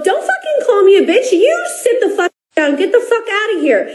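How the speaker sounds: hammed-up retort, half laughing; retold angry comeback. Fan retelling her comeback to LeBron, mock yell. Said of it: "And I go".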